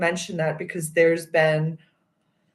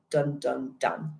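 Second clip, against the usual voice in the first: dramatic dun dun dun; 4 semitones lower, 6 dB quieter, slower than usual. Retold or imitated. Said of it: "I was like".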